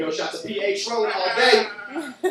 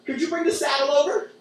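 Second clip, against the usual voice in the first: higher, louder needy customer; mock customer; 6 semitones higher, 4 dB louder than usual. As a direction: call the waiter eagerly. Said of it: "they're like".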